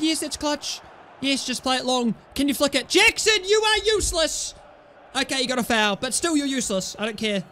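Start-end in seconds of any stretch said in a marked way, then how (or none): 2.9-4.5 high-pitched, exasperated scolding; exasperated yell. Real, not acted. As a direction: cry out at a useless player. Berating player for mistake.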